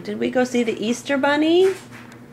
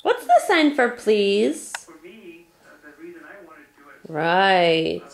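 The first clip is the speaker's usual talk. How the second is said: usual speaking voice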